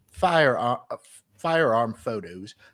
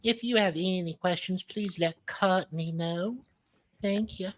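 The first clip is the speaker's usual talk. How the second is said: mock sweet southern lady; 7 semitones higher than usual. Reading Rachel's email aloud as a sugary southern lady.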